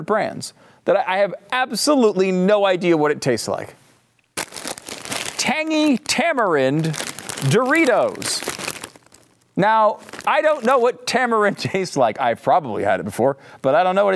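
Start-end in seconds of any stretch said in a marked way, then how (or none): 5.4-8.5 announcing. Real, not acted. Reading the product name.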